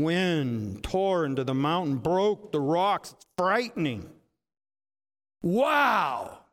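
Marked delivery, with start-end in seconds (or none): 5.4-6.4 loud, swooping wow; big drawn-out exclamation of awe; awed wow. Acted. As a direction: exclaim it like watching rocks shatter. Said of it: "It's a wow kind of thing".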